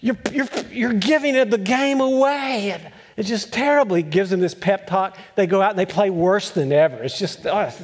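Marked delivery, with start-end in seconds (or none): 0.0-2.7 frustrated coach. Retold or imitated. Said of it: "he gets and goes".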